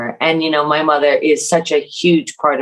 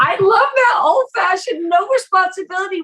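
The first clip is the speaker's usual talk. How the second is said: much higher, gushing and amused; delighted. Real, not acted. Telling Jenny she loves her dad's story, excited and much higher. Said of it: "gushing about Disneyland story".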